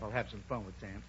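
low, soft aside; said to himself. Acted. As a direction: drop the voice as if privately plotting. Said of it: "he said to himself".

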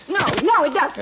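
loud, rattled no, no; upset denial. Acted. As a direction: deny it sharply. Wife denies, upset.

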